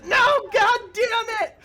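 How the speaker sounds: very high, loud, drawn-out scream; hammed-up cry of pain; agonized goblin. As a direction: shriek it as the nail goes in. Goblin cries out as nail hits.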